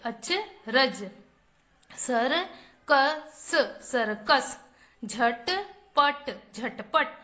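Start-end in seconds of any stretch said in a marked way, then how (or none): 1.9-3.5 circus stretched slowly as सर्-कस; syllable by syllable. Shown as a demonstration. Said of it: "चलिए बच्चों, पढ़ते हैं।". 5.0-6.3 झटपट stretched slowly as झ-ट-प-ट; syllable by syllable. Shown as a demonstration. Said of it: "चलिए बच्चों, पढ़ते हैं।".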